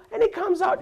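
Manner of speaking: usual speaking voice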